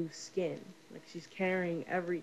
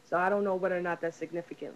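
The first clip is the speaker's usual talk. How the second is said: ordinary delivery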